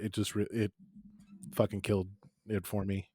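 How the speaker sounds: ordinary delivery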